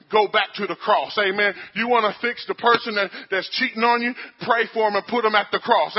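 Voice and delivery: loud, rising, driving sermon cadence; full-force preaching. Acted. Pastor exhorting the church, preaching at full force. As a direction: preach it hard at the congregation.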